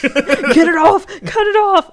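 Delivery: high, loud frantic imitation; hammed-up desperate cry; mock panicked yelling. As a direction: yelp for it to come off. Imitating victim begging for help.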